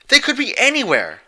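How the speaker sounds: high, loud desperate outcry; desperate shout. A narrator's reading. Dash protesting to Celestia, desperate. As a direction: shout it in frustration. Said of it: "Dash protested".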